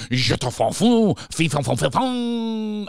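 gibberish French, last syllable held long and high; mock French accent. Host mimicking quiz presenter's French answers, hammed-up French accent. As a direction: overdo the French with a drawn-out finish. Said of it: "when he get to the French questions".

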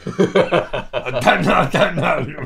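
laughing helplessly; words through laughter. Real, not acted. Laughing at own terror.

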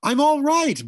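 high, loud yell; reassuring yell. A narrator's reading. Briggs yells to reassure the mother, high and loud. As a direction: yell it to calm her. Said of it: "Briggs yelled to reassure her".

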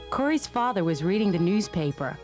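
plain reading voice

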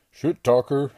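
enraged strongman yelling at the bear; yelled. A narrator's reading. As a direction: spit it out as a roar. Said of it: "yelled Bradley".

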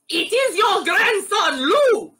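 high, shouted mock French accent; outrageous French accent. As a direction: taunt loudly in a hammed-up French accent. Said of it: "still with his outrageous French accent".

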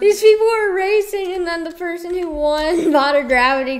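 high, loud laughing outrage; indignant, half-laughing rant; excited complaint. Real, not acted. Outraged at cheater winning race.

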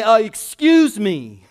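loud, high, slow mock objection; indignant challenge. Believer stands up to the world's experts, indignant challenge. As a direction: draw it out as a pointed interruption.

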